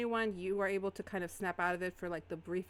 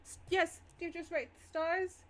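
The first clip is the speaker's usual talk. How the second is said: flustered Iris voice; 10 semitones higher, 4 dB louder than usual. Acted. Iris seizes on the suggestion.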